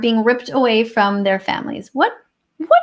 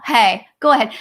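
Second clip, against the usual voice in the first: normal talking voice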